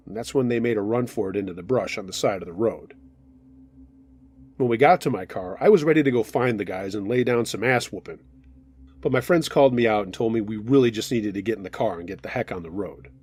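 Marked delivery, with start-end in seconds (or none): none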